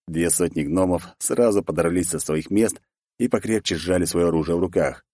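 ordinary delivery

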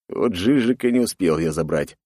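ordinary delivery

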